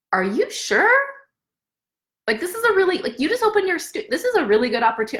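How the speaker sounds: imitating an amazed, pushy caller; incredulous guy. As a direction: play the stunned caller who can't believe she's saying no. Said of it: "he could not believe that I was passing it up".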